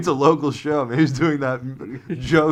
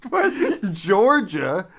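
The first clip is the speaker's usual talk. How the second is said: confused lost soldier; 7 semitones higher than usual. Retold or imitated. Continuing the lost soldier bit.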